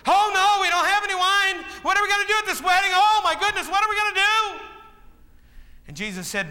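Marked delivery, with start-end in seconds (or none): 0.1-4.7 higher, fast, panicked cry; hammed-up wedding host in a panic; mock panic. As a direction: wail like a host out of wine. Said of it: "Remember? They ran out of wine".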